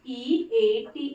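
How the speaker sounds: teacher spelling ई ए टी slowly. Shown as a demonstration. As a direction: spell ई ए टी letter by letter, very slow. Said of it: "इसका pronunciation ईट हो रहा है".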